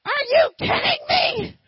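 hammed-up angry man accusing him; imitated shocked crowd. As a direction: throw the question back in disbelief. Angry men confronting him.

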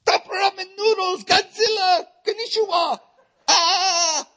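high, shouted, hammed-up Japanese accent; a high, loud mock Japanese babble. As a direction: yell it like a cartoon Japanese accent. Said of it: "it's like".

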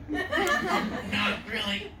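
real laughter breaking through the words; laughing. Real, not acted. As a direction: laugh while saying it, high and drawn out. Joking about the academy, laughing.

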